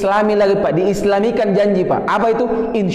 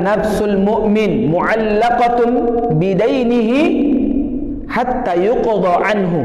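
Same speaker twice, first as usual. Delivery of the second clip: hadith recitation; slower than usual. Acted. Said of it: "Nabi katakan".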